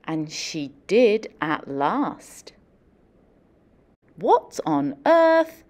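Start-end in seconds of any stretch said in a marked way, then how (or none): none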